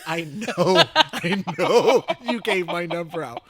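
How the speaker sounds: very loud, high mock yell through laughter; exasperated outburst. Son confronting mother over handing out his number, loud mock exasperation. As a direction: belt it out in laughing, hammed-up exasperation.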